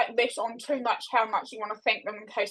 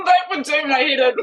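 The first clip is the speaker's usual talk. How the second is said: higher, louder, laughing reaction; 8 semitones higher, 4 dB louder than usual. Real, not acted. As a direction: blurt it out through real laughter. Amused reaction to last-minute debut news.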